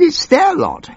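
very high hammed-up squeal; frantic squeal. Minister correcting the old MP, a very high squeal. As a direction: squeal it out in a flap.

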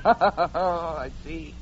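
laughing as the words come out; amused laugh. Acted. Proud gentleman answering a charge of family pride, laughing. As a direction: laugh off the reproach. Amused reply to her reproach.

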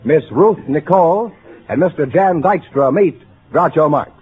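ordinary talk

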